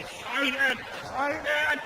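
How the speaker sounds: struggling to resist. Acted. Mocked politician fighting his own urges, struggling to resist. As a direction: urge yourself on under your breath.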